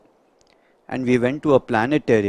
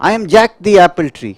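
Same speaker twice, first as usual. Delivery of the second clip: loud, fast proud tree introducing itself; boastful apple tree; 6 semitones higher, 10 dB louder, much faster than usual. Acted. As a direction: puff yourself up as Jack the tree. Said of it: "the apple tree starts talking to you".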